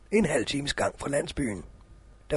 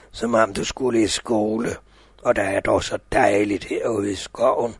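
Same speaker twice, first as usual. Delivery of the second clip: sweet-talking wolf; 8 semitones lower, 5 dB louder than usual. Acted. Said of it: "sagde den til hende".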